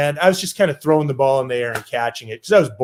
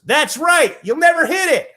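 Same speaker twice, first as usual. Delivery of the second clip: put-on much higher, cheery old legend voice; high approving Ted; 12 semitones higher than usual. Old Ted Williams approving the skinny kid, high and chirpy. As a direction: squeak out the praise like a delighted old man.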